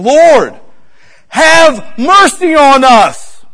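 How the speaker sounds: loud, high, slow plea for mercy; crying out. Retold or imitated. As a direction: cry out like a blind man on the roadside. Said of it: "crying out".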